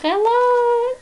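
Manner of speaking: high sung-out greeting; excited hello. Acted. Parent reading, mouse calls hello happily. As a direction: call hello brightly.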